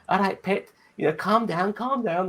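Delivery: high, exaggerated Scouse "calm down, calm down"; mock Scouse. Mocking Liverpudlians for a podcast audience in a high, exaggerated Scouse accent. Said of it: "we made fun of Liverpudlians, you know, going".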